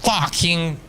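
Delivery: high, drawn-out startled cry; startled shout. Real, not acted. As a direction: react to a sudden scare. Surprise attack in game.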